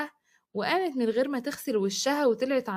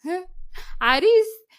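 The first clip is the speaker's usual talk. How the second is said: scornful scoff, higher and louder; mocking laugh; 7 semitones higher, 5 dB louder, slower than usual. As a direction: laugh off the idea with contempt. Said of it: "ضحكت زهرة باستهزاء".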